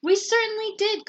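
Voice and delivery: high, eager cry; cried out. A narrator's reading. Kids answering their returning teacher, joyful cry. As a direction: blurt it out with joy. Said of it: "cried all the kids".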